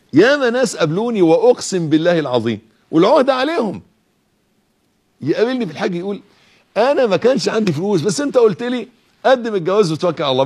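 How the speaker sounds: full-voiced pulpit exhortation; preaching at full force. Preacher exhorts trust in God.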